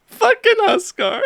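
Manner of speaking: high squeal through laughter; laughing too hard to talk; helpless laughter. Real, not acted. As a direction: squeeze the words out while cracking up. Cracking up at the clip's line.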